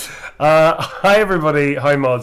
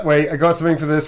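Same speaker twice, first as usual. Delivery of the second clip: ordinary delivery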